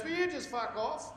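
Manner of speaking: snapped high, with a laugh; a girl snapping at him, played for laughs; mock snappy girl. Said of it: "She just looks at me and goes".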